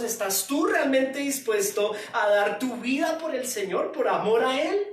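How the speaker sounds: loud, driving sermon delivery; fiery preaching. Acted. Pastor preaching at full force to the church. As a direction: preach it hard to the congregation.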